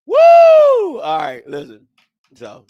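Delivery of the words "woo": high, loud, held "woo"; loud whoop. Real, not acted. Host recovering from a laughing fit, loud high whoop. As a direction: let out a big high whoop.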